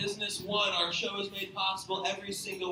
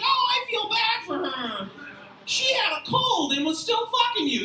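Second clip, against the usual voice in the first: mock sympathy; 11 semitones higher, 4 dB louder than usual. Mocking friend's fake sympathy.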